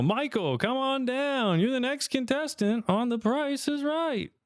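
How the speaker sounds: high, slow announcer call; game show host. Retold or imitated. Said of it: "he calls down".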